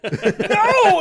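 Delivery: high, loud comic scream of protest; desperate cartoonish outcry; mock yell. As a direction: yell in hammed-up panic. Acts groundhog fighting the driver's foot.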